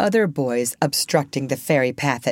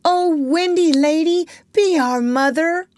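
put-on high, raised childlike plea; pleading cry; 12 semitones higher, 4 dB louder than usual. A narrator's reading. Kneeling boys begging Wendy to mother them, high pleading cry.

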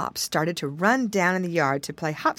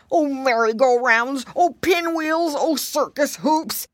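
dizzy snail crying out; 6 semitones higher, 3 dB louder than usual. A narrator's reading. Snail watching the top spin, high dizzy cry. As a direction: cry out high, stretching each exclamation. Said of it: "he cried out".

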